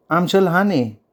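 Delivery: small and sheepish. A narrator's reading. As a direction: admit it with a small sparrow-like pout. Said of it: "चिमणीगत बारीक तोंड करत म्हटलं".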